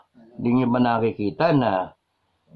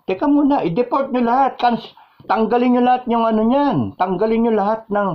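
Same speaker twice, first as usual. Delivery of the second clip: exasperated outburst; 9 semitones higher than usual. Real, not acted. Outburst demanding deportation.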